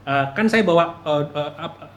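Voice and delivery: ordinary delivery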